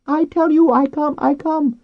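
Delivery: very high, loud dolphin squeal; talking dolphin. A narrator's reading. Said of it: "Flo said with a burble and a whoosh".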